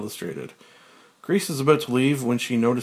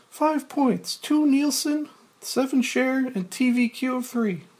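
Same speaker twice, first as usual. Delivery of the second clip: Grace's high voice; 14 semitones higher than usual. Acted. Grace reads ratings aloud.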